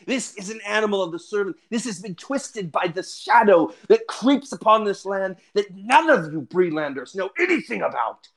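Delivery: heated fantasy-hero warning; indignant, warning ranger. Acted. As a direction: insist on the danger with dramatic force. Ranger argues the bird is evil.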